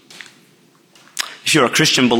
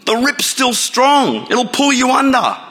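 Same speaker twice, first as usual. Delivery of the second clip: mock yell; 12 semitones higher, slower than usual. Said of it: "The lifeguards are yelling at him".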